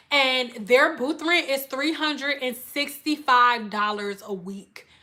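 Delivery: ordinary talk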